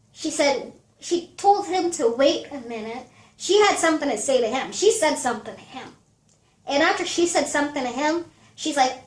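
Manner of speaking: normal talking voice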